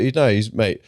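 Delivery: usual speaking voice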